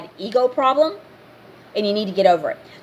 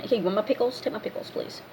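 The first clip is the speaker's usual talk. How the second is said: hushed, breathy offer of pickles; half-whispered mock offer; soft offer; 2 semitones lower, 11 dB quieter, faster than usual. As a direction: quietly offer your pickles to the next table.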